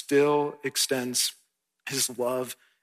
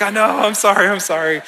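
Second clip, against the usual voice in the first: louder, higher, laughing through the sorry; laughing apology; 7 semitones higher, 13 dB louder, faster than usual. Retold or imitated. Pastor retelling apologizing to his son, laughing loudly. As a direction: apologize while cracking up. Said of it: "And I was like".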